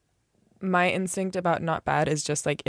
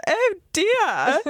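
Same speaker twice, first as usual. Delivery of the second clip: very high, hammed-up British "oh dear"; posh British accent; 11 semitones higher, 4 dB louder than usual. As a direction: do the queen, pitched up high. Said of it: "'cause I'm like".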